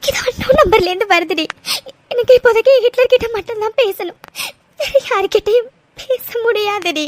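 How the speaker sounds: sobbing through the words; crying. Acted. Girl refusing the phone call to her friend, crying. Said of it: "அழுதுட்டே இருக்க போற".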